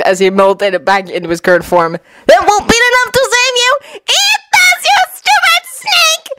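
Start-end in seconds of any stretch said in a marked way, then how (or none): 2.3-6.3 very high battle yell; high-pitched heroic taunt; shouting. A narrator's reading. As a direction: shout it in a squeaky boy voice. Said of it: "Naruto shouted out".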